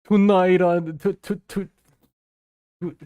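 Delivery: stretched, loud fumbling British accent try; loud try at a British accent on tonight; British tonight attempt. Shown as a demonstration. Streamer trying the British tonight for chat, loud fumbling accent. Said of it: "how do you make that noise".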